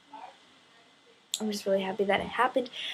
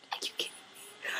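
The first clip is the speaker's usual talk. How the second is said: breathless whisper of disbelief; 18 dB quieter, faster than usual. Retold or imitated. Fan whispering her disbelief to herself, stunned and hushed. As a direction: say it under your breath, stunned. Her own reaction quoted.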